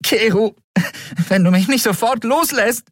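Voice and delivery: high, indignant warning; outraged high-pitched demand; threatening. A narrator's reading. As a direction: threaten him in protest. She threatens her captor.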